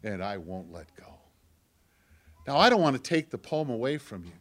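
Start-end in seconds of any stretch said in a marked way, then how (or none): 0.0-1.2 hushed, much softer and lower; God whispering. Retold or imitated. God answering the believer, whispered softly and low. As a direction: let it sink to a tender hush. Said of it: "And God whispers to me".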